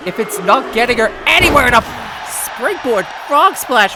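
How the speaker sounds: normal talking voice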